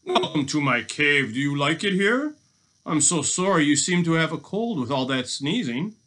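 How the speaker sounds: gloating robber feigning sympathy; jeering. Acted. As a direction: play the falsely gracious host. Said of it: "he jeered at him".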